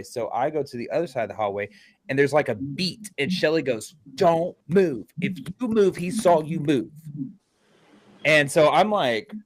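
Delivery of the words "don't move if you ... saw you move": higher-pitched, insistent quote of a warning; urgent retold warning. Medium warning the moving investigator, insistent mock command. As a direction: imitate her commanding him to stay still.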